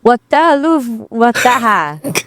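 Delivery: slow, high, garbled Singaporean attempt; botched Singaporean accent. AI copies the user, botched Singaporean accent. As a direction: draw out a badly faked Singaporean accent. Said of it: "repeat what I say".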